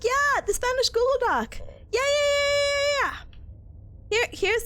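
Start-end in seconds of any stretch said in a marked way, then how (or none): 0.0-1.6 eager confirmation. Real, not acted. Answers own echoed question eagerly. 1.6-3.2 high, run-together string of yeahs; eager agreement. Real, not acted.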